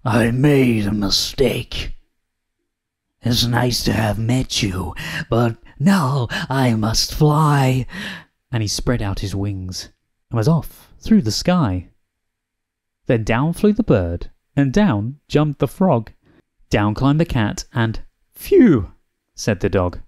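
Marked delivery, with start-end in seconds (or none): 0.1-7.9 slow, cowed dragon making excuses; spluttering. A narrator's reading. Dragon excusing himself to the beast, spluttering. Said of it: "he spluttered". 18.3-18.9 high, loud phew; relieved. A narrator's reading. Dog relieved the dragon has gone, relieved exhale.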